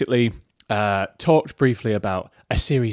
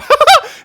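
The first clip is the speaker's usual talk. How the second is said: loud, very high squawking laugh; seagull laugh; 10 dB louder than usual. Real, not acted. Host breaking character on the podcast, loud high seagull laugh. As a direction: burst out in a high seagull-like cackle. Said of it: "to hear my seagull laugh".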